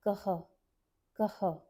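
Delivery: "kaha" with a breathy h at the end; "कः" aspirated visarga. Shown as a demonstration. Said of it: "add a h ... with an aspiration".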